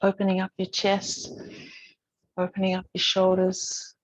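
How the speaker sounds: calm, unhurried instructor voice with long pauses; even, measured cues, leaving space to breathe; guided yoga meditation. Real, not acted. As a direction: gently guide a class through breath and movement. Leads breathing and gentle yoga.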